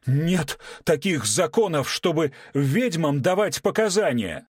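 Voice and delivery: high, loud desperate protest; desperate shout. A narrator's reading. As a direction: shout it out in outrage. Менеджер кричит в отчаянии. (отчаянный крик, выше и громче)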